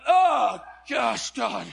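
hammed-up shout of revulsion; mock disgusted yelp. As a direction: yelp it like you just caught the stink. Recoiling as he smells breath.

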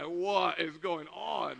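slow, drawn-out amazed question; blown away. Retold or imitated. Team facing two Rhondas at Walmart, blown away. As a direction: stretch it out in bafflement. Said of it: "now we're blown away".

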